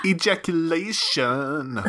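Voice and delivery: slow, high, stretched word; drawn-out silly echo. Pretend. Echoing the co-host's joke word, slow and stretched. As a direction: draw the word right out playfully.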